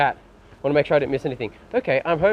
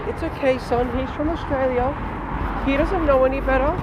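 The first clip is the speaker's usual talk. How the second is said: much higher, condescending mother voice; mock soothing mom; 10 semitones higher than usual. Imagined mom excusing the Australian to her son, mock sweet. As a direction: pat the kid on the head with the words. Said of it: "The mom turns around".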